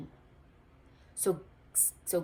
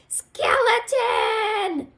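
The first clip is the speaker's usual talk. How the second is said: long, high, loud drawn-out shout; 14 semitones higher, 11 dB louder, much slower than usual. A narrator's reading. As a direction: call his friend's name across the crowd. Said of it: "shouted Goblin".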